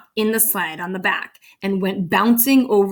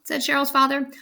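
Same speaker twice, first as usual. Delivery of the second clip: plain reading voice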